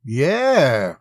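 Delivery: loud, drawn-out yeah with disdain; disdainful. A narrator's reading. Monty answers the young officer's threat, disdainful. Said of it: "threw disdain at the young officer".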